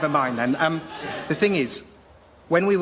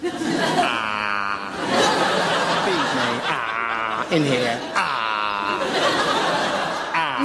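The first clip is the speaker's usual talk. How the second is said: put-on low squawking baby bird cries; a hungry chick squawk; begging chick; 8 semitones lower, much slower than usual. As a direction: gape and beg for a worm. Cuckoo chick begging food.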